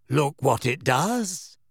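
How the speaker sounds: slow, vague, dreamy voice; dreamy Luna. A narrator's reading. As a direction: drift through it, unhurried. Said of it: "a vague and dreamy voice".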